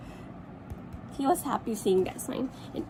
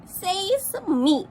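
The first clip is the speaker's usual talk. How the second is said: bossy duck; 7 semitones higher than usual. Acted. Duck answers back.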